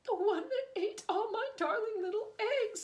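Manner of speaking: high, loud tearful wail; bursting into tears. Acted. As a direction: sob it out, heartbroken. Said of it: "she burst into tears".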